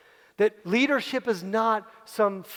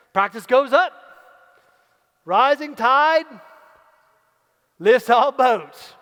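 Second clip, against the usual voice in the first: loud and slow, each phrase driven home; rally the team, rising tide lifts all boats; rallying cry; 3 semitones higher, 8 dB louder, slower than usual. Acted. Coach rallying the staff: loud, slow rallying cry.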